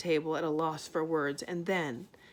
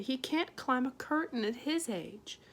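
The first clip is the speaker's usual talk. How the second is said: higher, anxious; worried mother; 6 semitones higher than usual. A narrator's reading. Homily fretting to Arietti, higher and anxious. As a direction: fret about the old man. Homily worrying about Pod.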